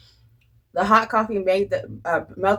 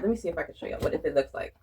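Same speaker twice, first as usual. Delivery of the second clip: usual speaking voice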